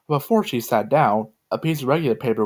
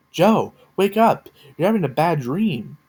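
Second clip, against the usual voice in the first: high, slow, urgent call; shouting; 6 semitones higher, slower than usual. A narrator's reading. Meg waking Joe from a bad dream, urgent shout. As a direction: shout to wake her from a nightmare. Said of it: "Meg shouted".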